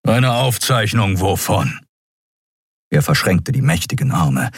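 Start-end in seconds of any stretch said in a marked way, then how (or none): 0.1-1.8 slow, gruff questioning; distrustful Keru. Acted. Keru questions Endriel about the recording, gruff and suspicious. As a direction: query it with heavy suspicion.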